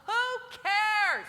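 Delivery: a high, loud "who cares"; dismissive. Real, not acted. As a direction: toss it off big and scornful. Dismissing what others think.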